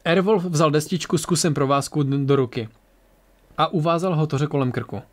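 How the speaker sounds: ordinary delivery, nothing marked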